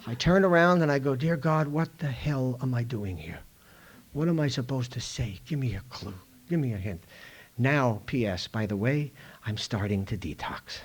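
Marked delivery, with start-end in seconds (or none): 1.1-7.0 soft, low pleading prayer; desperate prayer. Retold or imitated. Actor privately prays to God for a line, soft and low. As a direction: beg God quietly for a clue.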